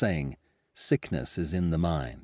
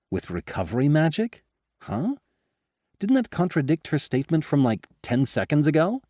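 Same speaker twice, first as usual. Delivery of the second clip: baffled, rising higher and louder; incredulous; 8 semitones higher, 4 dB louder than usual. Acted. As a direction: pitch jumps up in disbelief. King stunned by impossible cure.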